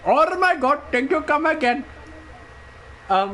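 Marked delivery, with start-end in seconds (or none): 0.1-1.9 high, put-on Indian accent; Apu. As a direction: do a cartoon Indian shopkeeper accent. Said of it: "Apu was one of my favorite characters".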